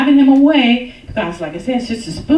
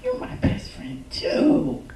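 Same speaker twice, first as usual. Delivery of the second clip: tender whisper to husband; 5 semitones higher, 7 dB quieter than usual. Retold or imitated.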